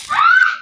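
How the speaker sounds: high-pitched shriek; high scream. Real, not acted. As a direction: let out a sudden high scream. Scream during play. (matinis na sigaw)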